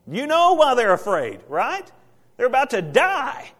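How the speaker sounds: loud, high, driving storm sermon; preaching at full force. Acted. Preacher to congregation, loud and rising.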